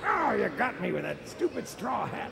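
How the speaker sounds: defeated villain. Acted.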